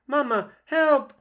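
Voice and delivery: high, loud, slow squeak for help; a tiny gosling in danger. Acted. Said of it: "squeaked Bright Bill".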